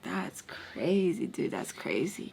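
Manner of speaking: slow hushed whisper. Retold or imitated. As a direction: breathe it out, amazed. Hushed low amazement.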